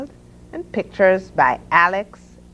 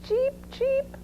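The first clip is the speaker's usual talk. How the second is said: very high, tiny duckling chirps; duckling cheeps; 9 dB quieter than usual. A narrator's reading. Ducklings chime in after Mother Duck, high cheeping. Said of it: "said the ducklings".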